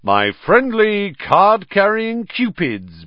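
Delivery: high, slow and beaming. A narrator's reading. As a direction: proudly present them, beaming. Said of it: "beamed Lockhart".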